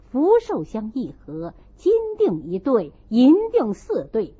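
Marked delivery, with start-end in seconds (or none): none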